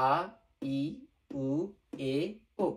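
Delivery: আ, ই, উ, এ, ও one at a time as separate vowels; pronunciation demo. Shown as a demonstration. Teacher reciting Japanese vowels for learners, আ, ই, উ, এ, ও spaced out. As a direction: sound out আ, ই, উ, এ, ও with a pause after each. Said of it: "আমি আবার pronunciation বলতেছি".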